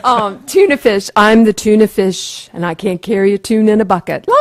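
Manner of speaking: loud, hammed-up school play character; tuna fish voice. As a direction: shout it like a kindergarten actor. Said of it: "I could do this very well".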